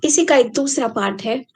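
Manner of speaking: ordinary talk, nothing marked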